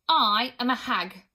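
loud, slow, indignant declaration; huffy. A narrator's reading. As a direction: draw yourself up and announce it huffily. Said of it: "she said huffily".

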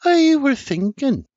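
Sarah coaxing Senior with a smile; warm persuasive smile. A narrator's reading. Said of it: "With a warm, persuasive smile".

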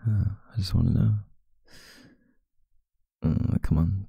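usual speaking voice

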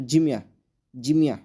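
"জিমিয়া" stressed firmly, jim-iya; "জিমিয়া" as an emphatic model for learners; Russian pronunciation demo. Shown as a demonstration. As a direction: say "জিমিয়া" with an i in the first syllable. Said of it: "উচ্চারণটা হবে".

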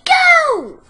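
very high, loud piglet squeal; frantic high-pitched shouting. A narrator's reading. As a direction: shriek to be let go. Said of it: "The piglet kicked and squealed".

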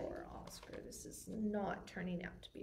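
breathy whispered pity; whispered aside. Real, not acted. Whispered aside during story.

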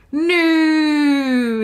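a mild read-aloud scream; understated death scream. A narrator's reading. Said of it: "he screamed".

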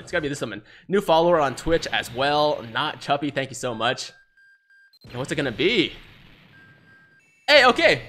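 ordinary talk, nothing marked

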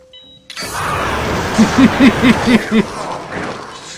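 loud high villain laugh; evil cackle. Acted. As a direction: gloat with a cackle. Laughs after kill.

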